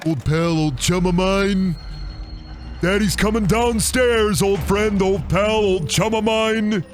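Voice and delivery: high, slow sing-song menace; hammed-up creepy villain. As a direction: croon it like a cartoon villain. Hamming up game villain lines.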